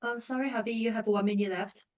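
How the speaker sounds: ordinary delivery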